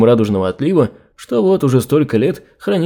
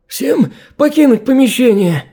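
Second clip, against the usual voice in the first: hoarse shout, much higher; after breathing tube; 11 semitones higher than usual. A narrator's reading. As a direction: rasp it out after the gag comes off. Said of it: "Сиплым голосом выдвинул он".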